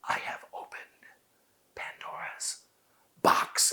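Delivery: slow, whispered I have opened Pandora's box; whispered with long pauses between words; slow and hushed. Shown as a demonstration. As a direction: hush it, slow, spaced out. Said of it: "No, no".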